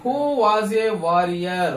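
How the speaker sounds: teacher dictating slowly; slow demo. Shown as a demonstration. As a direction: spell out the sentence slowly for students. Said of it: "ఇక్కడ చూడండి ఒకసారి".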